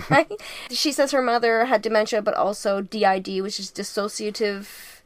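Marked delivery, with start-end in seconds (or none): none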